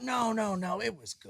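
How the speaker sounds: soft, high mock reassurance; small placating voice, imitating; hushed dismissal. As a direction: brush it off very softly. Acts out producers brushing him off.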